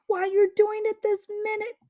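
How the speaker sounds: loud high-pitched bellow. Acted. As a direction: bellow it out indignantly. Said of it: "bellowed Trumpkin".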